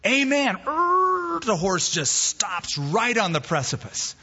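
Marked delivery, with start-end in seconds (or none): 0.1-0.6 high, urgent last-second command; panicked cry. Acted. Rider at the ravine's edge remembering, high urgent cry. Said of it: "he remembers and he says". 0.7-1.4 very high, sustained skid sound; screeching halt noise. Acted. Storyteller voicing the horse's sudden stop, high screech.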